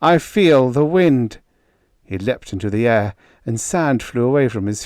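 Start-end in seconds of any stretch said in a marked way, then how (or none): 0.0-1.4 higher, louder, slow and rapturous; overjoyed wonder, loud and drawn out; ecstatic cry. Acted. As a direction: drink in the feeling, marvelling aloud. Ecstatic rebirth, feeling wind.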